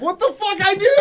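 high, loud mock-offended squeak; Dean's indignant reply. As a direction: play the friend protesting in a squeaky put-on voice. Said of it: "he was like".

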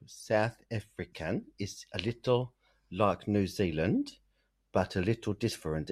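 slow, hesitant South African accent attempt; trying a South African accent. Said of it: "Right, channel".

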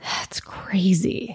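slow, hushed disbelief; a breathy aside of shock; whispered. Real, not acted. Stunned whispered reaction.

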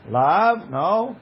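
rising, disbelieving no; a mock-surprised no with a lift; incredulous question. Shown as a demonstration. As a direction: ask it back in astonishment. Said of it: "'cause he said it bitmiha".

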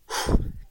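one loud breathy sigh out; demonstrating a deep calming exhale. Shown as a demonstration. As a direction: let out a big releasing sigh. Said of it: "Take a deep breath".

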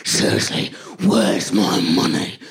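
low, slow mock-livid demand; aggressive. Livid mugger repeating his demand, slow aggressive growl. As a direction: imitate a mugger shaking with rage, drawing out each word. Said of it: "a lot more aggressively".

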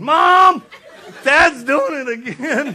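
put-on high, loud laughing girl's whine, slow; whining daughter. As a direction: tattle to mom through laughter. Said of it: "My daughter's going".

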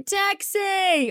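high mock-American call of Taxi; a friend's sudden American hail; American taxi shout. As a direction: hail a cab in a put-on American accent. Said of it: "started shouting".

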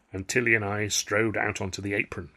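plain reading voice

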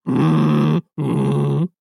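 low humming hiss of steam; robot rhino steam. Acted. Robotic rhino Rudy billowing steam, low mechanical hum. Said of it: "seems to billow steam".

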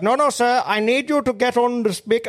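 high, hammed-up Indian call-centre voice; mock Indian accent. Mate imitating the Indian scam caller, high mock accent. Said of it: "this fucking Indian bloke".

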